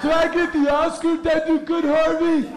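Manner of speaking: high starstruck actress voice, fluttery and pleased; breathy starlet. Retold or imitated. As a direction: play a dazed Hollywood starlet at the Oscars. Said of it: "Just like".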